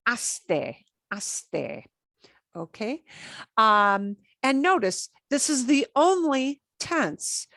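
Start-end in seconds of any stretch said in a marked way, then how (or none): 0.1-1.9 aste spoken softly and carefully, syllable by syllable; clear slow ending. Shown as a demonstration. Teacher modeling the ending for students, softly. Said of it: "That's kind of a long ending".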